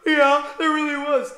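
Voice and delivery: high, loud frightened child imitation; scared kid voice. Babysitter imitating a spooked child answering him. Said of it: "they'd be like".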